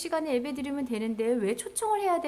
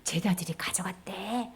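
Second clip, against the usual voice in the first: hushed rumour, passed along; soft, low acted gossip; gossiping whisper; 6 semitones lower, 5 dB quieter than usual. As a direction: whisper a secret to a neighbour. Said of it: "뭐라고 소문이 났느냐면".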